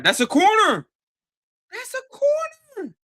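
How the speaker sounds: high, loud insistence; indignant yell. Real, not acted. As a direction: shout the call at the ref. Insisting at the screen.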